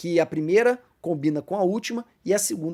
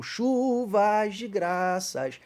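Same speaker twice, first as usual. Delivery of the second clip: very slow, drawn-out reading of the hymn verse; slow hymn line; 3 semitones higher, much slower than usual. Shown as a demonstration. Teacher quoting a hymn verse to the class, slowly drawn out. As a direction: stretch each word of the hymn line. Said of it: "é o refrão do louvor".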